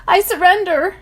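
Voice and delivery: high loud yelled surrender; yelling. Acted. Mac surrendering to a hidden voice, yelled high and loud. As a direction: throw the words out in panic. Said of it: "Mac yells".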